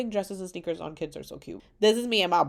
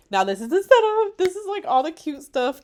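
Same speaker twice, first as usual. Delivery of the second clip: excited; 10 semitones higher, 8 dB louder than usual. Real, not acted. Excited showing wedding photos.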